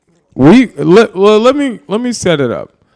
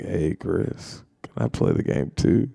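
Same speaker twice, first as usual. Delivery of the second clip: small, low and soft; timid quiet asking; 7 semitones lower, 12 dB quieter than usual. Retold or imitated. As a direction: ask meekly, barely audible. Asking selfish roommate for turn.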